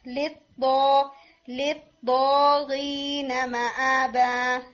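drawn-out tajweed recitation of للطاغين مآبا; slow teacher demo of tajweed; slow Quranic recitation. Shown as a demonstration. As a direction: recite للطاغين مآبا slowly with full Arabic letters. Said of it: "بالکل اس طرح سے".

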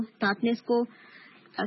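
ordinary delivery

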